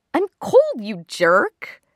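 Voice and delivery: high, loud squeal of protest; indignant, high-pitched whine at a partner; mock-angry complaint. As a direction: scold your lover for the cold. Woken woman scolds her boyfriend.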